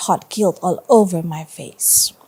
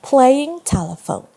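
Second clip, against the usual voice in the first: ordinary delivery, nothing marked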